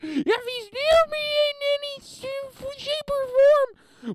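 put-on high, loud, whining kid voice near tears; mock the allergic kid tattling to the teacher; whiny allergic kid. Comedian mocks allergic schoolkid, high whiny near-crying tattle. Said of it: "that kid ... allergic to peanuts".

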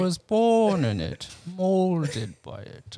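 loom over him like a masked villain; Bane impression. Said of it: "I bring up the Bane quotes".

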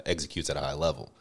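ordinary delivery

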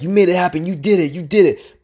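high, excited self-cheer; retold inner screaming. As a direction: cheer yourself on with rising force. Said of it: "just screaming this in my head".

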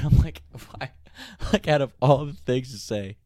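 incredulous, on the edge of laughing; suppressed laughter. Retold or imitated. Driver hearing buddy's lame excuse, trying not to laugh. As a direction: hold back a laugh in disbelief. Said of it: "I'm trying not to laugh".

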